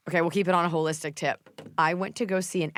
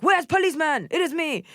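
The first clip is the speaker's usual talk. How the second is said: imitating the doctor; 10 semitones higher than usual. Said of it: "I was like".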